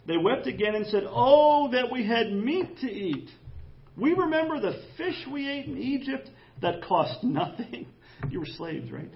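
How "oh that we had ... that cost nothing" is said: high, loud hammed-up whine; a pleading moan about fish; mock wailing lament. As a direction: wail it like spoiled complainers. Israelites wept, quoted in mock lament.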